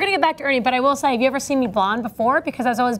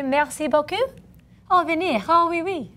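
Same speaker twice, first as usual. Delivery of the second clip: mock French; 5 semitones higher than usual. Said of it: "That's my version of, of French".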